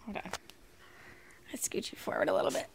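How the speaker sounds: hushed, hurried under-the-breath words; whisper. Real, not acted. Mom quietly whispering to baby while moving him.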